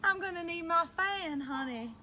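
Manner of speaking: hammed-up southern belle accent. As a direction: play up a southern accent, starting high and falling. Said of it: "talk in my southern accent".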